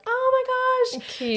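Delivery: high, slow, drawn-out gasp; imitating friends' delighted surprise; surprised gasp. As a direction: stretch it like friends touched by the ask. Friends reacting to bridesmaid ask.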